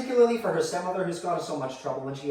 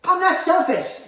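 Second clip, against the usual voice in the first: very high, loud protest; outraged denial; 12 semitones higher, 7 dB louder, slower than usual. Acted. Mother accused of being selfish, outraged. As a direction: burst out in indignant denial.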